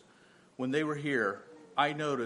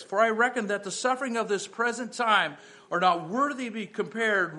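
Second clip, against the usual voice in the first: raised, louder preaching voice; 6 semitones higher, 4 dB louder than usual. Acted. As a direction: preach it out with rising fervor. Preaching at full force.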